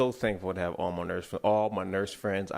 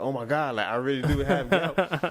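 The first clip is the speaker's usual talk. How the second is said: higher, louder mock panic; a touch of hammed-up alarm; 6 semitones higher, 5 dB louder than usual. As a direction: replay the moment of freaking out. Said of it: "that's when I started freaking out".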